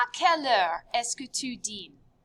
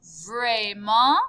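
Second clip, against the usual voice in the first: drawn-out doubtful rise; slow-rising sceptical query; unsure, questioning; 5 dB louder than usual. Shown as a demonstration. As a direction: sound unconvinced, sliding up at the end. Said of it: "you're questioning their thought process".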